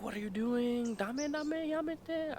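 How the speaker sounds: high-pitched imitation of a protesting woman; high, drawn-out feminine mock alarm. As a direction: squeal the objection as if scandalized.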